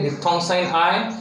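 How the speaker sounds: usual speaking voice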